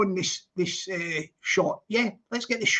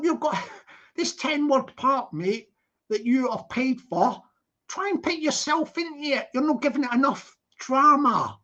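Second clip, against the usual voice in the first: ordinary talk, nothing marked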